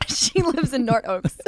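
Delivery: laughing, slow mock Irish voice; a silly Irish lilt for the character; mock Irish accent. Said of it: "a character from Ireland".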